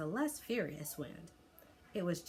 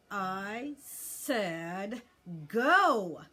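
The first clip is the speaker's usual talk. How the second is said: exasperated, losing patience with the dragon; frustrated; 3 semitones higher, 8 dB louder, much slower than usual. A narrator's reading. Boy repeating the command to a dragon that won't fly, frustrated. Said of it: "Hiccup repeated in frustration".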